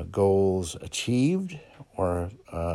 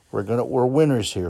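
louder, faster, higher hype; pumped up; 4 semitones higher, 5 dB louder, faster than usual. Real, not acted. Host hyping up his listeners, fast and loud. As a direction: get them believing they're winners.

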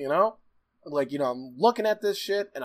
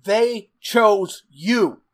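each word drawn out loud and higher; slow, emphatic scolding; 4 semitones higher, 7 dB louder, much slower than usual. Retold or imitated. Boss scolding the man who lost his changer, slow and forceful. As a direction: land heavily on every word, very slowly.

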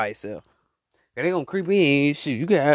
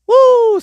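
excited whoop; 8 dB louder than usual. Real, not acted. Hyping up his listeners.

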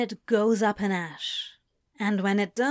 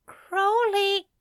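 high, tearful angel crying his name; a choked cry of the beloved's name; tearful Crowley cry; 11 semitones higher, 3 dB louder than usual. A narrator's reading. Stunned angel cries out to Crowley, high and tearful.

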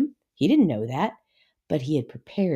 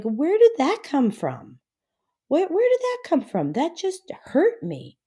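much higher, stunned disbelief at a sudden blow; shocked and hurt; 11 semitones higher, 4 dB louder than usual. Pretend. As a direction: cry out as if blindsided. Imagined reaction to sudden hurt.

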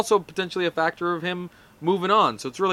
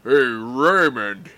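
brother voice; 3 semitones lower, 4 dB louder, much slower than usual. Retold or imitated. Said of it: "I'll be Ray's brother".

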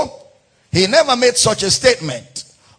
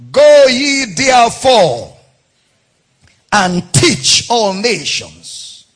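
thundered commission; 7 dB louder than usual. Acted. Preacher thundering the great commission to his listeners. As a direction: boom the command like a herald.

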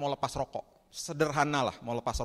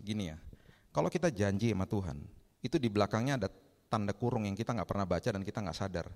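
ordinary talk

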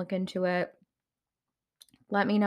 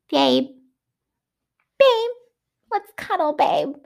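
high, loud mock pet-name cooing; mocking lovey-dovey; 9 semitones higher, 8 dB louder than usual. Viewer fed up with a TV couple, mocking their cuddly talk. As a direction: imitate a clingy partner in a much higher voice.

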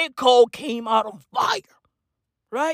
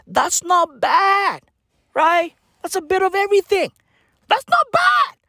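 building hype; 7 semitones higher, 5 dB louder than usual. Real, not acted. Building hype about stats.

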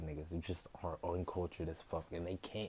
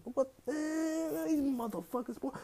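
outraged; slower than usual. Real, not acted. Outraged rant peak.

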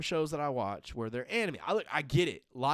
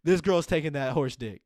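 louder blunt punchline; 3 semitones higher, 11 dB louder than usual. Real, not acted. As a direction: land it loudly for laughs. Punchline about BoJack.

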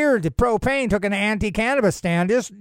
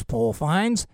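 ordinary delivery

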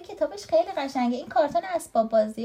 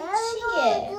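grumpy question, pitched up; mildly irritated story character; impatient; 8 semitones higher, much slower than usual. A narrator's reading. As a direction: answer as if bothered from sleep.

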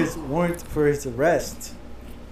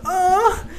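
high, hammed-up moan; mock moan; 14 semitones higher than usual. Imitating the coworker's prank on a scammer, mock moan. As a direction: let out a fake high moan. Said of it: "He keeps fucking moaning".

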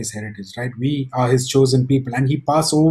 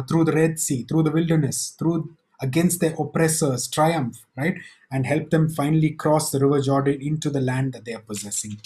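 normal talking voice

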